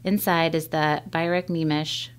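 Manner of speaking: ordinary delivery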